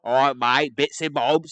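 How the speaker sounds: loud, caricatured British voice; mock British accent. Imitating Brits for British friends, mock British accent. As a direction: do a bad Brit, loudly. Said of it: "Brits sound like?".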